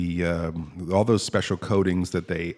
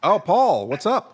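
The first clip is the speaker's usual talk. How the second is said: high boss voice; 8 semitones higher, 5 dB louder than usual. Acted. Skit boss character greets Paul.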